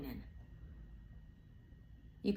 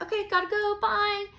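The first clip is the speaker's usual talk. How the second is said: loud, much higher chirpy goodbye; breezy brush-off; 13 semitones higher, 6 dB louder than usual. Retold or imitated. Passerby leaving Capricorn behind, loud chirpy goodbye. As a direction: chirp a quick exit.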